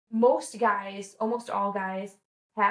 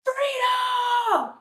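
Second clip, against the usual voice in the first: long, high battle-cry shout; Tony Robbins yelling; 5 dB louder than usual. Acted. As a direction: bellow it out full force, drawn out. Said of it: "he yelled".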